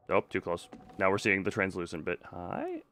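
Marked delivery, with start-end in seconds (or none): none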